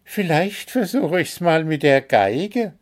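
high-voiced musing; thinking aloud. A narrator's reading. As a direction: muse aloud in a high character voice. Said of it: "überlegte er laut". (hoch, laut überlegend)

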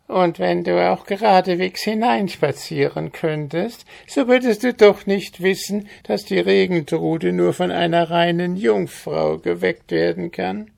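high squawking goblin, smugly teasing; quäkender Kobold. Acted. Kobold voiced in story. (hohe, hämisch quäkende Koboldstimme)

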